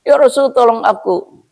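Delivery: high, begging camel voice; pleading camel. Acted. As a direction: beg for help like a desperate animal. (unta memohon dengan suara tinggi)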